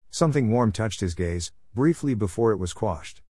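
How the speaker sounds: ordinary delivery, nothing marked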